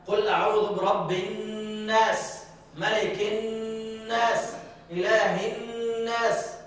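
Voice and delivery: very slow recitation holding the nasal hum on الناس; slow Quranic recitation, nasal sound held long; tajweed recitation with strong ghunna. Shown as a demonstration. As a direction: chant slowly, stretching the nasal ghunna in الناس. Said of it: "الغنة هنا قوية".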